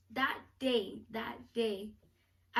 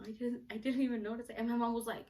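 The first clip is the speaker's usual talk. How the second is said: normal talking voice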